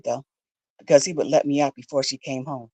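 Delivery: normal talking voice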